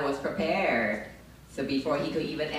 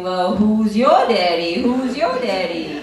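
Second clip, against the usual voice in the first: loud, slow, higher repeat; loud mock question; 6 semitones higher, 12 dB louder, slower than usual. She copies the guy, loud and slow. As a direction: throw the line back at him, hammed up. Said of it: "So I asked him".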